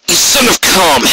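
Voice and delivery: angry exclamation. Acted. Angry captain scolds Rainbow.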